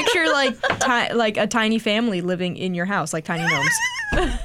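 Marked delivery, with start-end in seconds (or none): 3.4-4.4 high slow squeal on gnomes; squeaky. Real, not acted. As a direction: squeal the word like it's adorable. Describing the movie, squealing.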